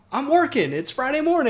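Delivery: high, loud, incredulous retort; indignant exclamation; exasperated. Retold or imitated. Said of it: "I'm like".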